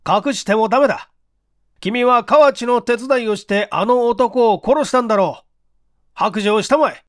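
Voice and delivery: stern accusing chief. Acted. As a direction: press him to confess.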